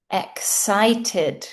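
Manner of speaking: ordinary talk, nothing marked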